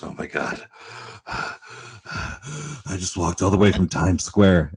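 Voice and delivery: gasping, slow, drained mock breathlessness; out of breath. A winded tourist telling the shop clerk he walked miles, imitated out of breath. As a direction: sigh hard and drag the words out. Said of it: "out of breath, like he was about to die".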